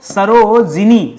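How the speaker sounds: सरोजिनी said very slowly with a clear short इ before नी; slow spelling out. Shown as a demonstration. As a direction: drag out सरोजिनी syllable by syllable. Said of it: "सरोजिनी होता है".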